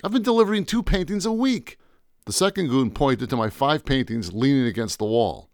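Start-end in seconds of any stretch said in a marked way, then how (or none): 0.0-1.8 much higher nervous protest; panicked. Acted. Painter pleading with the goons, panicked. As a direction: insist in a panic. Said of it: "I said in a panic".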